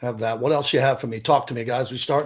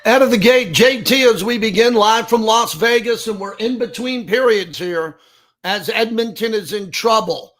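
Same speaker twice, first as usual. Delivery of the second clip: punchy, projected on-air host delivery, loud off the top; sports radio open; 7 semitones higher, 5 dB louder than usual. Real, not acted. Host opening live from Las Vegas between periods, punchy broadcast voice. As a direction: launch the show live with big, punched-up announcer energy.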